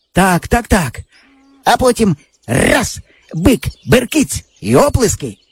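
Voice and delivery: put-on boyish friend. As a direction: brag like a cocky village boy. Java describes the bullfight.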